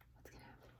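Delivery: soft suspense whisper. A narrator's reading.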